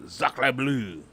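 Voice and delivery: hammed-up French accent, drawn out. Recounting his French accent to his co-host, exaggerated French accent. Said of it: "I was doing a French accent".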